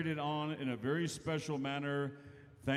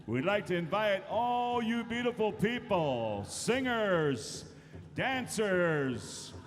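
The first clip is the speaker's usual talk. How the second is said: hype announcer; 6 semitones higher, 4 dB louder, slower than usual. Acted. Emcee hypes crowd to dance.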